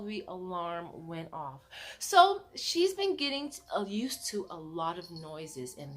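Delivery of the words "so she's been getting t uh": usual speaking voice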